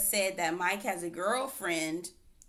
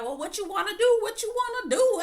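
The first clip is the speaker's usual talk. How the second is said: higher, louder taunting voice; mock tough challenge; 13 semitones higher, 4 dB louder than usual. Swayze squaring up, calling someone out, mock tough taunt. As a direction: act out the guy squaring up to fight. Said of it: "starts being like".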